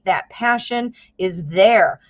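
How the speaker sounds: ordinary delivery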